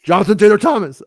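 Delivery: high, loud cartoon teen impression; Beavis. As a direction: belt it out as a goofy cartoon teen. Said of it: "who talked like Beavis forever".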